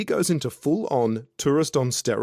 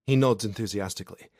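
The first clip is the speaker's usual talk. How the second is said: ordinary delivery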